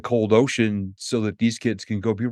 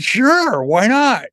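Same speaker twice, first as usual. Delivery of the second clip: higher, louder, breezy yes; mock carefree yes; 12 semitones higher, 5 dB louder, much slower than usual. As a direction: toss it off like it's nothing. Retold eager acceptance.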